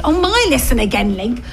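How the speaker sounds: normal talking voice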